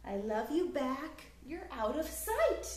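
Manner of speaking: ordinary delivery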